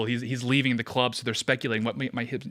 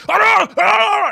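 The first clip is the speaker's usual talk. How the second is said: loud, high-pitched mock Scottish gibberish; exaggerated Scottish holler; unintelligible Scottish shouting; 10 dB louder than usual. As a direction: bellow wordless noise like an incomprehensible Scotsman. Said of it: "hearing a Scottish guy just like".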